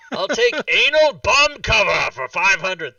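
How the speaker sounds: slow, loud quiz contestant voice; game show contestant. Retold or imitated. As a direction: announce it like a game show pick. Jeopardy contestant parody.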